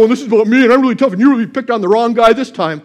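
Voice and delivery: loud, high mock tough-guy boasting; angry bravado. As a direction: puff it up like an angry braggart. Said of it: "You don't see David's angry vibrato".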